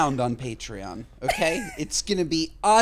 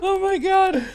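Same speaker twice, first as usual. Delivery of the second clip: high-pitched, helpless laughing reaction; laughing disbelief; 11 semitones higher, 5 dB louder than usual. Real, not acted. Co-host reacting to the retold speech, laughing. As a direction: gasp the words out through laughter. Cracking up at the Trump story.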